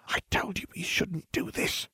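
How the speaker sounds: urgent, reproachful whisper; hissed whisper. A narrator's reading. Hermione scolds her friend as the professor arrives, hissing. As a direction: hiss it under your breath. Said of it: "Hermione hissed".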